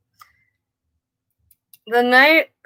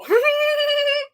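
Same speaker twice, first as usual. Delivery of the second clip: high-pitched squealing giggle; high laugh. Real, not acted. Cat owner amused by the meowing cat, high laugh.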